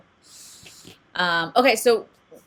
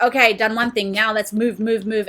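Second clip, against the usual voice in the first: louder, higher mock urging; imitated impatient go-getter; 4 semitones higher, 9 dB louder than usual. Voicing people who rush onward after a job, mock urgency. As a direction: push on to the next task, lightly acted. Voices people rushing to next task.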